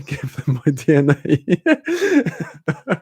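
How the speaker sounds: laughing through the words, slow; giggling at own paranoia. Real, not acted. Laughing while explaining why she avoids DNA tests.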